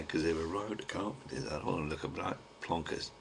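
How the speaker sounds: quiet sign-off, trying not to be overheard; hushed. Real, not acted. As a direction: keep it down so the carpenters won't hear. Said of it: "I better shut me mush now".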